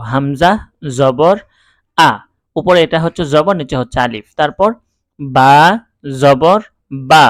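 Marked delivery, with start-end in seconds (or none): 0.1-2.1 হামজা জবর আ spelled aloud, each part louder and separate; teacher drilling Arabic spelling. Shown as a demonstration. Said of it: "এভাবে আমরা বানান করব". 5.2-7.2 বা said short and loud, with no drawn-out vowel; teacher showing a short বা; short fatha. Shown as a demonstration. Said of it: "টান দিব না".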